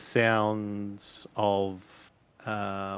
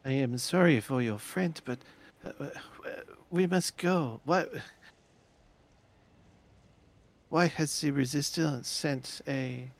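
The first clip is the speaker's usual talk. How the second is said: put-on higher, hurried accented woman; urgent foreign woman; 6 semitones higher than usual. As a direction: urge them to hurry, worried. GM voices Greta.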